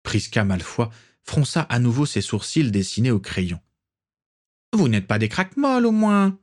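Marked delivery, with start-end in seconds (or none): 4.7-6.4 put-on higher, rising suspicious old aristocrat; haughty matriarch, suspicious; suspicious grande dame. A narrator's reading. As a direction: probe for a hint of scandal. Prisca voice, suspicious.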